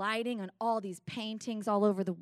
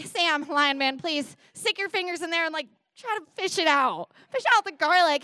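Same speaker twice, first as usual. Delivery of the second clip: high, loud, urgent plea; embarrassed pleading; 7 semitones higher, 6 dB louder than usual. Retold or imitated. Begging lion man to fish out garlic, frantic. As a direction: beg him in comic desperation.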